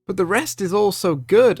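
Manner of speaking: usual speaking voice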